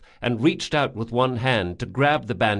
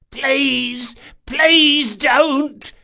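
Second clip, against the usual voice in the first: high, pleading, slow panicked whine; terrified begging; 14 semitones higher, 7 dB louder, much slower than usual. A narrator's reading. Bandit begging Locke not to drop him, terrified plea. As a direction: beg for your life.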